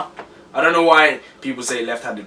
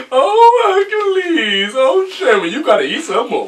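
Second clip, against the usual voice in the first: put-on high, loud wailing old mother, sobbing his name; hammed-up sobbing mama, much higher and louder; Eddie Murphy's crying mother; 9 semitones higher, 7 dB louder than usual. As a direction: wail like a doting mother crying over her boy. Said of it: "a character that Eddie Murphy did".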